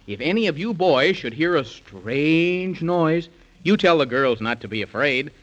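ordinary delivery

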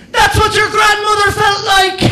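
high, loud jeering yell; mocking shout at girlfriend; taunting shout. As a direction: yell it through the door in triumph. Taunting through bathroom door.

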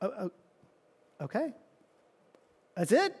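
deflated and unsure; confused letdown. Retold or imitated. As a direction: lepers imitated, baffled by the short reply. Lepers let down, confused.

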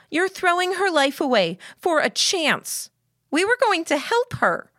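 put-on high-pitched, upset girl protesting; distraught Lillian. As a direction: protest desperately, pitch well up.

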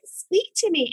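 high, eager invitation; keen wide-eyed urging; eager curious self. Retold or imitated. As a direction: lean in, all ears.

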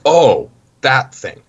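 drawn-out, exasperated realisation. Retold or imitated. Taxi driver finally understands the foreigner's word, exasperated. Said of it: "he eventually exasperatedly says".

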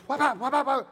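quick, punchy imitated bangs; crashing walker noise. Preacher imitating a thrown walker hitting wood, loud bangs. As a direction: make it clatter loud and high.

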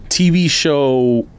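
ordinary talk, nothing marked

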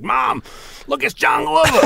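high-pitched, gushing cry to mom; hammed-up squeal of star-struck recognition; excited fan. Telling mom he spotted his favorite actor, excited.

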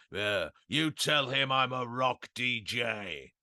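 slow, drawn-out voice of a wrecked DJ; mock grandeur through a hangover, dragging the words; hungover Tommy Vance. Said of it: "just hung over to death".

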